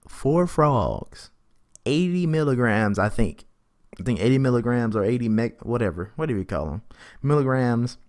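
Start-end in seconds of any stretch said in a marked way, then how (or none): none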